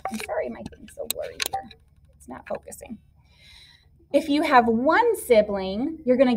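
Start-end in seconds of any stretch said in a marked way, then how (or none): 0.1-3.0 whispered aside. Real, not acted. Camera blurry, mutters apology aside.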